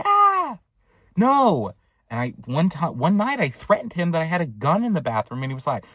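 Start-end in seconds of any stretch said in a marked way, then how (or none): 0.0-1.7 high, loud mock scream, then no; startled refusal. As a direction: act out a panicked refusal. Startled reply to door banging.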